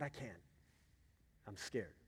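soft, timid imitation of a child; retold fearful refusal, hushed; scared child. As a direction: shrink the voice down quietly. Daughter refusing, frightened.

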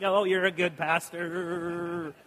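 slow, drawn-out mock praise; patronizing pat. Guy giving the pastor a noogie, slow mock fondness. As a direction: pat him on the head with the words.

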